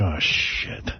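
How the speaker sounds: low, breathless unvoiced aside; muttered under breath. Ignoring the heckler while walking with Pat, whispered aside. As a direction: mutter it so nobody hears. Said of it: "I'm like".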